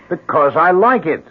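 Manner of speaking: defensive snap. Acted. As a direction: push back hard at her. Defensive answer to wife's question.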